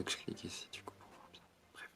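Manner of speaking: quick whisper to herself. Real, not acted. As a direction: whisper it under your breath, fast. Muttering to herself at phone.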